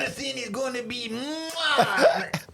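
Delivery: put-on over-the-top French accent. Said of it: "he then answered in French".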